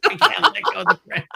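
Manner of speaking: high, fast squeal, laughing through it. Pretend. Host refusing to let go of the tug, laughing squeal. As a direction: squeal it out, cracking up.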